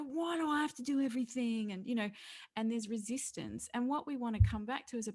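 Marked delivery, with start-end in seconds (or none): 0.0-1.7 put-on light whiny kid protest; whiny child complaint. Resistant child voiced.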